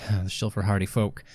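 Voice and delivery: ordinary talk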